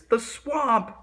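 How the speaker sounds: high alarmed yelp; frightened cry. A narrator's reading. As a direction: gasp it out in fright. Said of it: "cried the kids".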